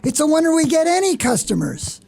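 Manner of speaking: high-pitched, indignant boss's complaint; scolding. Acted. Boss telling staff the shop window looks terrible, scolding. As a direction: lay into the staff, drawing out the grievance.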